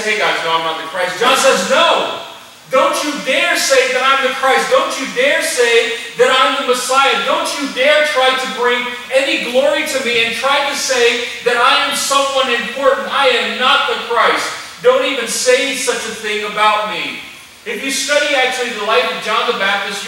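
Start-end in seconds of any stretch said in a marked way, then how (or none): 0.0-1.2 low, offhand and shrugging; casual mock denial. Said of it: "John didn't simply say". 1.7-17.2 emphatic rejection. Retold or imitated. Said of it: "emphatically strong rejection".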